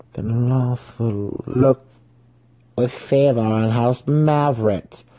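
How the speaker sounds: slow, measured reasoning in a character voice; lightly voiced slow reasoning. A narrator's reading. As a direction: reason it out slowly. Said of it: "Brisa reasoned".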